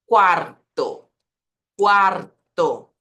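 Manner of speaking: loud Quarto with emphatic rolled R; vibrating R. Shown as a demonstration. Teacher showing learners the vibration, emphatic trilled R. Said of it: "tenho a minha vibração ali".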